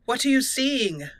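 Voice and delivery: normal talking voice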